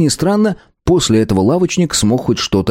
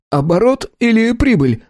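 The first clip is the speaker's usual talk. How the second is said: frightened shopkeeper; 13 semitones higher than usual. A narrator's reading. Scared shopkeeper asks.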